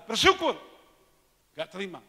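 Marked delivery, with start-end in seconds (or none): 0.0-0.6 loud pastor's command. Retold or imitated. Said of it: "Pendeta yang bilang".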